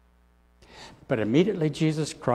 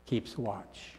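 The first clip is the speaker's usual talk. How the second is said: "keeps watch" repeated much softer, like an echo; quiet echo; 3 semitones lower, 11 dB quieter than usual. Shown as a demonstration. Preacher echoing his own words softly to the congregation. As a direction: let "keeps watch" fall away to a hush. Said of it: "Christ keeps watch".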